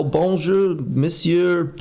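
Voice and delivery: terrible French accent, lifted pitch; bad French accent. Shown as a demonstration. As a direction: greet in a badly faked French accent. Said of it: "that was my worst ever French accent".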